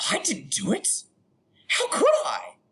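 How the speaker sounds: high-pitched, acted protest; mock indignant denial. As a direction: protest your innocence in a squeaky overdone voice. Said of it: "And you'd be like".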